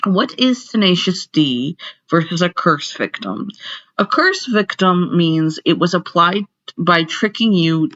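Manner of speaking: loud, declaiming lecture voice. Acted. Teacher back to the lecture, projecting loudly. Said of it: "So back to lecture".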